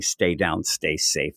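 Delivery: low, odd repetition of dying words; weird parroting. Retold or imitated. Predator repeating the dying man's words, low and weird. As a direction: lightly mimic it in a weird low way. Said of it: "in a weird way".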